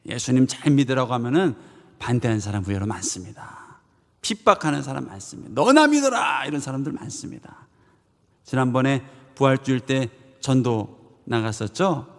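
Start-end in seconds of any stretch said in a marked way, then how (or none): 5.5-6.5 high, sharp mock brush-off; pitched-up dismissive jeer; scornful retort. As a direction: toss it back like a scoffing stranger. Scoffing passerby rejecting evangelism.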